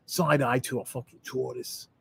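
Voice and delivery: lightly attempted Australian accent; mock Australian accent. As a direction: imitate an accent that drifts Australian. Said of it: "you sounded Australian".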